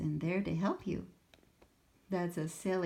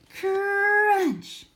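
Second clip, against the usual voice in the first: loud, much higher crunching noise; 13 dB louder than usual. Acted. Sammy eats the classroom furniture with a loud crunch. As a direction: chomp it out loud.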